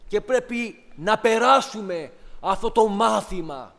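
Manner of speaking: πιο ψηλά και πιο δυνατά, κηρύσσοντας; φώναξε στο εκκλησίασμα με πάθος; κήρυγμα με πάθος. Acted. Κήρυγμα με ένταση. (ο ιεροκήρυκας μιλά στους αδελφούς πιο ψηλά και δυνατά)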